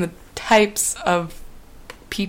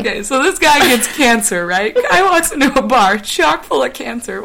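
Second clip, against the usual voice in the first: louder, higher sarcastic joke setup; mock joke setup; 5 semitones higher, 5 dB louder than usual. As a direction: parody a bad bar joke.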